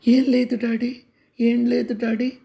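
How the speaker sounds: put-on child catching himself, hastily covering up; sudden panicked denial. Child keeps denying, scared. (భయంతో గబాల్న తిరస్కరించడం)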